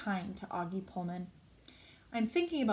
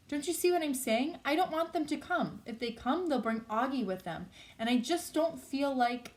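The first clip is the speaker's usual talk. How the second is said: higher, exasperated plea; impatient; 6 semitones higher, 3 dB louder than usual. A narrator's reading. Olivia explains to Justin why parents mustn't come, impatiently. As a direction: press the point impatiently, pitched up. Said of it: "she interrupts impatiently".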